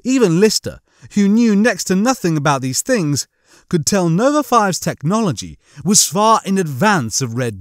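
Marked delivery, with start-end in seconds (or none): none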